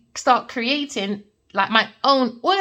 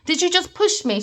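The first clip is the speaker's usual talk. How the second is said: confronting again; 9 semitones higher than usual. Retold or imitated. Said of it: "let's start again".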